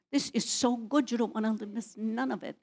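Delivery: ordinary delivery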